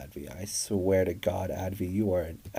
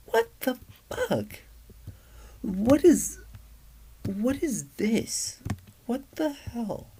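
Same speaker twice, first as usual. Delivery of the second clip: much higher, slow, stunned exclamations; shocked disbelief; slower than usual. Real, not acted. Reading creepy chat messages aloud, high slow shocked disbelief. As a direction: drag it out, utterly aghast.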